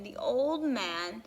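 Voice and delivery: ordinary delivery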